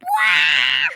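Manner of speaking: loud, low fake crying wail; mock wail. Child showing how she cried, loud mock wail. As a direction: bawl like a baby for show. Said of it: "eu fiquei chorando".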